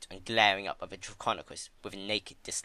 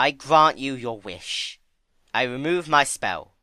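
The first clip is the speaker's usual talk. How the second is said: slow, louder, higher grave declaration; solemn Discord; 4 semitones higher, 8 dB louder than usual. Acted. Discord solemnly lifting his spell for Fluttershy. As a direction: grant it slowly, with weight.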